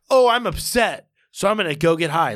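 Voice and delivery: high, loud mock self-pity; mocking whine. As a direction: sneer at her fake distress. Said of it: "Like, that's not real".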